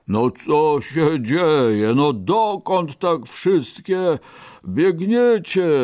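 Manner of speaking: slow, drawn-out bear voice; sleepy bear. A narrator's reading. Woken bear asks the rabbits, slow sleepy bear voice. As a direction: stretch the words like a big drowsy bear. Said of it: "przetarł zaspane oczy i powiedział".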